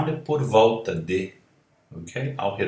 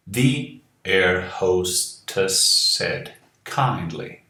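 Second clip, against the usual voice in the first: slow reading; much slower than usual. Shown as a demonstration.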